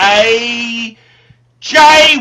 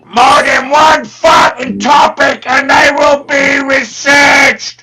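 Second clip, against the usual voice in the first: loud ranting Cockney trader voice, swearing at the clients; shouting Cockney character; 5 semitones higher, 6 dB louder than usual. Acted. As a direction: bellow the price list in a Cockney accent. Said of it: "carried away there with the character".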